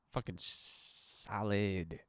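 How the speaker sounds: drawn-out very slowly and soft; slow, deep-set delivery. Shown as a demonstration. Podcaster savoring his dialed-in voice. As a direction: let solid hang, very slow. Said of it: "I dial that shit in".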